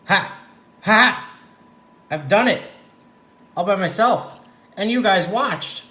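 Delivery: high, loud victory cry; triumphant shout. Real, not acted. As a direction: crow with triumph. Triumphs after finishing knot.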